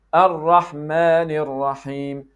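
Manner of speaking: 'Ar-Rahman Ar-Rahim' slow, tongue-tip Rs touched once; correct doubled R. Shown as a demonstration. Teacher modelling the right way, careful tapped R. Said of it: "It is".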